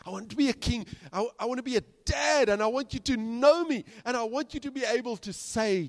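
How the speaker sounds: God longing to be known, pressing hard; fervent plea as a loving father; impassioned plea. Acted. God pleads, voiced in sermon.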